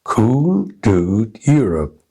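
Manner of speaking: slow, evenly stressed beats on "Cool", "dude", "Europe"; rhythm drill. Shown as a demonstration. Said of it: "stressing only the content words".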